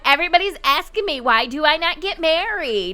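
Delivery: high, fast complaining sister voice; whiny rant as the sister; mock exasperated Sim complaining about marriage; voicing the sister. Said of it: "She's like".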